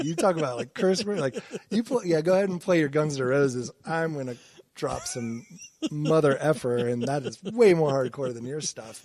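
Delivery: mock taunting boast. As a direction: loudly taunt the neighbor in a retold boast. Acted boast to rock-loving neighbor.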